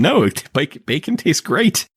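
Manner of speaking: high, drawn-out mock protest; high-pitched, slow quoted retort; Florence. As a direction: imitate a character disagreeing, pitched well up. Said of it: "And Florence said".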